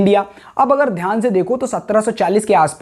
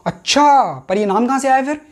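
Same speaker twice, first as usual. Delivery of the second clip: higher, questioning voice of a listener; curious interjection; 5 semitones higher than usual. Acted. Acts curious viewer asking back. (जिज्ञासु श्रोता जैसा सवाल)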